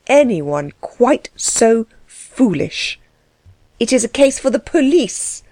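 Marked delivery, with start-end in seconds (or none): 3.8-5.4 passionate outburst. A narrator's reading. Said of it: "burst out the Baroness passionately".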